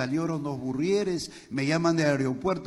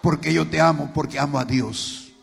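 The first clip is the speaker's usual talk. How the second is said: louder, driving testimony voice; fervent preaching; 4 semitones higher, 8 dB louder than usual. Acted. Pastor preaches his wife's vow loudly to the congregation. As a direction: preach it to the back row.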